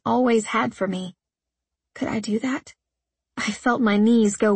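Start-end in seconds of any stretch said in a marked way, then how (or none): 1.9-2.7 soft breathy whisper. A narrator's reading. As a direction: barely voice it. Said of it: "unvoiced whisper".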